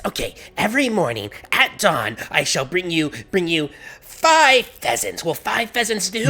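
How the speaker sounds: high, solemn pledge rising to a plea; anxious villager. Acted. Villager offers the devil a daily tribute, anxiously eager. Game master plays panicked villager.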